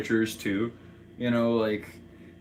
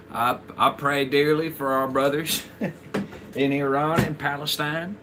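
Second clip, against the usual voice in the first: higher-pitched mock Southern accent; Florida man impression; 4 semitones higher than usual. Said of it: "your Florida man impression".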